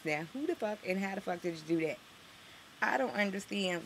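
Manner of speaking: normal talking voice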